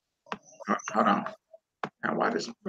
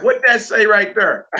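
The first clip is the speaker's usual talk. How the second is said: higher-pitched mock puzzled question; imagined onlookers; 8 semitones higher, 4 dB louder than usual. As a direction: playfully mimic puzzled onlookers. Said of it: "They're gonna be like".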